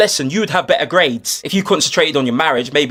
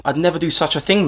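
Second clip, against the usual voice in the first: normal talking voice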